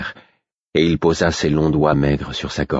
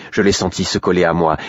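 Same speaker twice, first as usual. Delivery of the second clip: usual speaking voice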